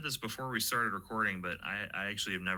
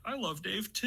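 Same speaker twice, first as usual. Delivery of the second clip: imitating fans; 14 semitones higher than usual. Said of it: "five people who are like".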